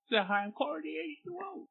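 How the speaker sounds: high-pitched Waterboy voice; Waterboy impression. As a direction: squeak it like the Waterboy. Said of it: "you're Adam Sandler".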